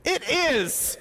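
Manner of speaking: high, sulky retort; sulky Jonah. Acted. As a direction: snap back like a pouting prophet.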